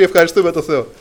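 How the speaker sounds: ordinary delivery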